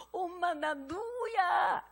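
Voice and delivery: hammed-up tearful cub asking mother; crying tiger cub. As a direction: whimper it like a beaten little cub. Beaten cub comes home crying.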